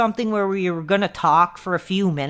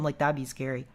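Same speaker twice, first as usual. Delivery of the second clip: usual speaking voice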